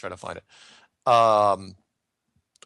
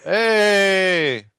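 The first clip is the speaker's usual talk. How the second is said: loud high "hey"; excited greeting; 10 semitones higher, 9 dB louder than usual. Real, not acted. Host greeting an overseas caller on air, excited. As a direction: greet the caller with a big hello.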